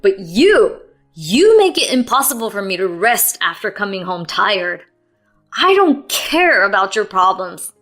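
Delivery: loud, angry tirade; venting fury. A narrator's reading. Husband venting at wife about her complaints, loud tirade. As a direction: unload all of it on her. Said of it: "He was venting pent-up frustrations".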